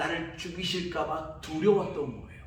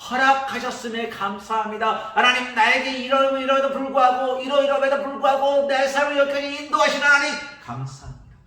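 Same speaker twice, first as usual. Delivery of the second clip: higher and louder gratitude; fervent thanks; 10 semitones higher, 9 dB louder than usual. Acted. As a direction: pour out thanks with rising intensity. Thankful person's prayer. (감사하는 사람의 벅찬 감사 기도)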